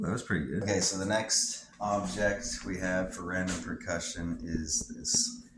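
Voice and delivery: normal talking voice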